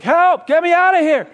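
high, loud cry for help; a terrified man screaming to get out of the cage; panicked shout. Retold or imitated. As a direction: yell to be rescued, in full panic. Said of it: "he starts to shout".

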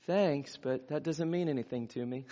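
soft, hesitant polite dismissal; doubtful brush-off. Acted. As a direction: play an unconvinced listener, softly letting them down. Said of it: "squint and think about it and say".

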